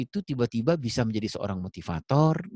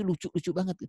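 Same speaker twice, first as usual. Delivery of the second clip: put-on eager child; 8 semitones higher, 4 dB quieter than usual.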